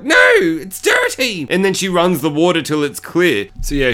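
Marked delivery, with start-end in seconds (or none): 0.0-1.5 high, drawn-out mock shout; panicked mum imitation. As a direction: mimic a mum crying out to stop a child. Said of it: "the mum had to quickly grab the cup and she's like".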